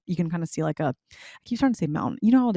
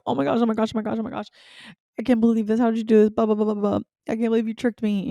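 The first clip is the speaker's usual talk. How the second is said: higher-pitched excited babble; overwhelmed gushing; 4 semitones higher, faster than usual. Retold or imitated. Newly engaged woman babbling to her fiancé, overwhelmed. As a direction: gush in disbelief, higher than usual. Re-enacts overwhelmed reaction to proposal.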